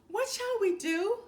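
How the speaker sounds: high loud despairing cry; sobbed. A narrator's reading. Poor father to his wife, sobbing in despair. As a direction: sob it out in despair. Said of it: "sobbed the poor father in despair".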